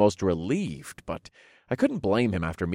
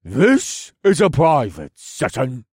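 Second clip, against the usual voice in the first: slow, curt, a bit louder rebuke; snapped; 4 semitones higher, 4 dB louder, slower than usual. Acted. Duke dismissing the visitors, snapping. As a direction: cut them off sharply. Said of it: "the Duke snapped".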